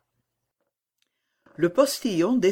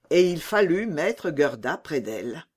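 ordinary delivery, nothing marked

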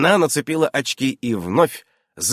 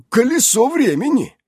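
hesitant, high-pitched admission; reluctant, awkward confession; halting confession; 7 dB louder than usual. A narrator's reading. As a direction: stumble into owning up. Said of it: "запинаясь, признался Иннокентий".

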